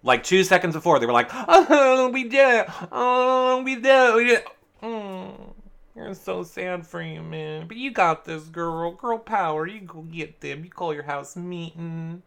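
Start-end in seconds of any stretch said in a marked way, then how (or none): 1.3-4.5 high, squealing mock celebration; giddy high-pitched mimicry; overjoyed celebration. Imitating schemers celebrating overjoyed. 4.8-12.2 soft, higher, sugary mock support; small cooing put-on pity; fake sympathy. Fake sympathy after instantly detaching.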